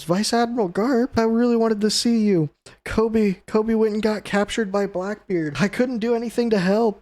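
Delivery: hammed-up sobbing plea to his hero; mock crying. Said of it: "still bawling his eyes out, crying".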